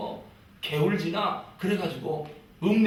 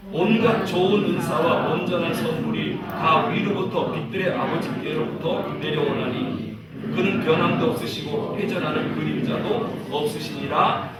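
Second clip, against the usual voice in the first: measured, even group recitation; scripture reading aloud; 2 semitones lower, 4 dB louder than usual. Real, not acted. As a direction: read the verse out firmly for all to follow. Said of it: "읽어보겠습니다". (또박또박 성경을 합독하는 낭독)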